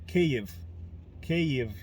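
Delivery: Kyiv as 'kay-eev', repeated with a pause; Ukrainian pronunciation. Shown as a demonstration. Vlogger drilling the capital's name for viewers, Ukrainian Kyiv. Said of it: "with Russian accent".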